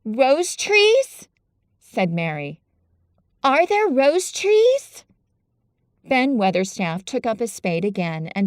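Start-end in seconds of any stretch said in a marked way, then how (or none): 0.0-1.3 much higher, louder astonished question; seizing on the words; astonished girl. Acted. Mary astonished. 3.4-5.0 much higher, louder astonished question; seizing on the words; astonished girl. Acted. Mary astonished.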